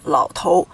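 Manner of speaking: "老头" with a clean ou ending, lao tou; no erhua. Shown as a demonstration. Teacher giving the Taiwan reading, "老头" without erhua. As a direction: end "老头" without any r colouring. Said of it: "they do not have the 儿化音".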